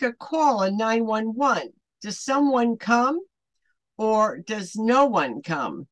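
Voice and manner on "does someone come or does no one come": ordinary talk, nothing marked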